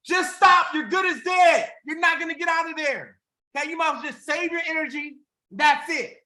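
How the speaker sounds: high, loud yell down into a pit. Retold or imitated. Friends yell at the frog in the pit, loud high shouting. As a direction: shout them down, stop trying. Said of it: "they would yell louder and louder".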